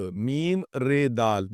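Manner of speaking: letter by letter, slow; slow classroom spelling; spelled out slowly. Shown as a demonstration. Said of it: "مرد،".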